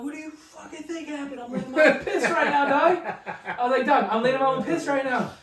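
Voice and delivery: high, loud, laughing retort; mock-shout back at dad while cracking up; laughing mock yell.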